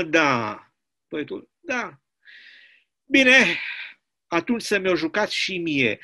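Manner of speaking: plain reading voice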